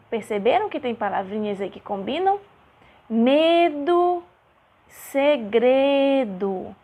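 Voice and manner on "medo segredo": "Medo, segredo" loud and stretched out; rhyme emphasis. Shown as a demonstration. Teacher showing children a rhyme, "Medo, segredo" slow and emphatic. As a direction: draw out "Medo, segredo" to show the shared ending. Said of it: "Olha o som das palavras".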